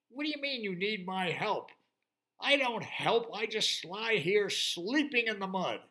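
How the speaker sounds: high, loud lazy pig protest; indignant pig. Acted. Lazy pig refusing the unicorn, loud and indignant. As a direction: scoff at the very idea.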